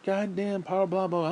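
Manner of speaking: normal talking voice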